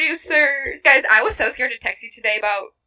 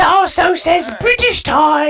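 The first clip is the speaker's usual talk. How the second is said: put-on British accent; 4 semitones higher than usual. Cohost reading the paper aloud in mock British accent. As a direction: proclaim it in a hammed-up British voice. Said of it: "speaking in British accents".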